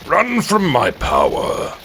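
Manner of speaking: mock evil king gloat; hammed-up villain voice; villain taunt. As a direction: gloat like a tyrant over the fleeing train.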